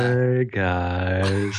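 low, lazy creature voice; low sloth voice. Acted. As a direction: greet them like a dopey sloth. Sloth character greeting.

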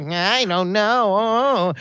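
slow, drawn-out mock whine. Player mimics his hesitant partner, mocking whine. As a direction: drag it out like a reluctant whiner. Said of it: "all I hear is like".

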